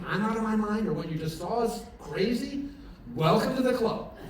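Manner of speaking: high, loud, building sermon; high, loud preaching with a laugh at the end; preaching at full force. Acted. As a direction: lift the room toward the punchline. Preaching climax of chapel talk.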